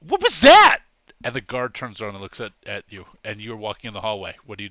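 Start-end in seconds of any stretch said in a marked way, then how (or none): 0.1-0.8 very high, loud shocked yell; high-pitched shout of disbelief; startled tourist. Acted. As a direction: shriek the question in alarm. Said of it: "another tourist has just walked in".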